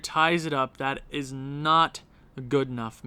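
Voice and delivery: normal talking voice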